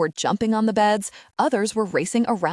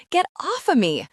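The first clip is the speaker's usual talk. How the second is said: roaring; 9 semitones higher than usual. A narrator's reading. Pinned boy yells at Arthur, high roar. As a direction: roar it from under the bully. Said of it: "Shane roared".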